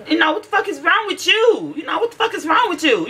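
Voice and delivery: high-pitched exasperated outburst; exasperated scolding. Retold or imitated. Parent scolding her whispering daughter, exasperated.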